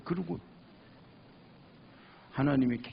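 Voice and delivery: usual speaking voice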